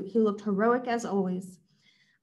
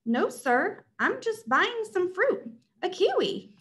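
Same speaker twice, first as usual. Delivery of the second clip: much higher, slow anxious voice; nervous shopper; 10 semitones higher, slower than usual. Acted. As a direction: squeak it out nervously.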